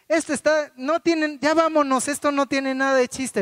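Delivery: put-on a girl demanding to leave the cave; bored little girl. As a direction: wail it like a fed-up four-year-old. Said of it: "y de repente".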